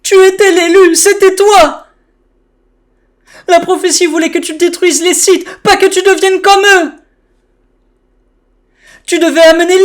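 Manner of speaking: high, loud, heartbroken yell; anguished shouting. Acted. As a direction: cry it out in despair. Dubbing Obi-Wan's anguished outburst.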